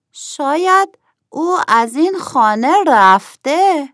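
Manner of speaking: high-pitched little worried voice. A narrator's reading. Nokhodi fearing the host has left the house, worried and high. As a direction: fret in a high character voice.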